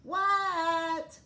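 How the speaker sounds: put-on high, loud squeaky kid reply; Froggy answering his teacher; high child voice. A narrator's reading. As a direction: answer back like a small frog.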